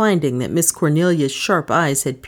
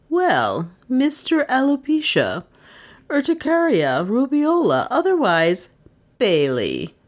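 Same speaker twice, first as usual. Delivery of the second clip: higher, savoring each mock name; great satisfaction; 9 semitones higher than usual. A narrator's reading. Miss Cornelia unmasking Bailey, gleefully satisfied. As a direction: relish unmasking him. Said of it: "in tones of great satisfaction".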